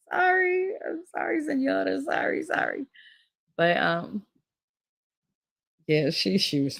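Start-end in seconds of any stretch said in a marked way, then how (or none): 0.1-2.8 high-pitched, hurried little sorries; meek mock apology. Child apologising to friend's mother after a smack, mock-meek. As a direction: play a cowed child apologising.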